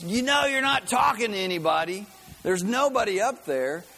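lightly voiced mocking atheist; scoffing neighbor. Atheist neighbor mocking her prayer, light scoff. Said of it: "And he goes".

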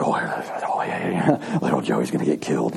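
hushed, low, mostly voiceless aside; imitated congregation murmur. Congregation watching Joey carried out, hushed mock murmur. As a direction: murmur it like onlookers in the pews.

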